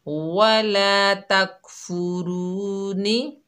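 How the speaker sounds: very slow chanted वला तकफुरूनी; Quran recitation. Shown as a demonstration. Teacher recites the whole word for students, slow chant. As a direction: recite it slowly, stretching the vowels. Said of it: "नी पढ़ेंगे".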